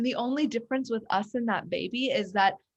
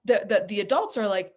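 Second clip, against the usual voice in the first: ordinary talk, nothing marked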